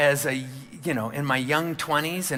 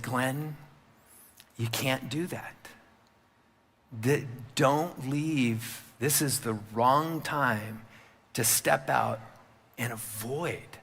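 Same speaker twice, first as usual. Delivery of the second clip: softer, slow, steady counsel; calm mentor; 2 semitones lower, 4 dB quieter, slower than usual. Retold or imitated. Senior pastor counseling Glenn late at night, calm and slow. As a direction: talk him down quietly and slowly. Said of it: "in a very calm voice".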